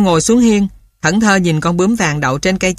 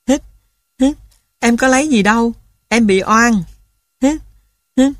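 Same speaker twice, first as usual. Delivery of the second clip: put-on sniffling boy; 5 semitones higher than usual. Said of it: "ngồi khóc tỉ tê".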